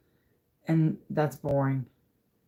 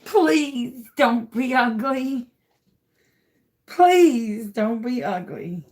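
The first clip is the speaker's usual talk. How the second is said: slow pleading; 7 semitones higher, 5 dB louder, slower than usual. Real, not acted. Begging a pour to turn out.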